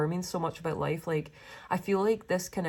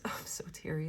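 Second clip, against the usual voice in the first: breathy whisper with a little laugh; whispered, teary; 7 dB quieter than usual. Real, not acted. Reader overcome, whispering to viewers. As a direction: whisper it through the emotion.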